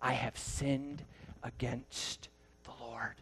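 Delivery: slow, soft, broken confession; a touch of weeping, slow and soft; hushed weeping confession. Retold or imitated. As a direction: let the words sink low like he is near tears. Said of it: "he begins to weep".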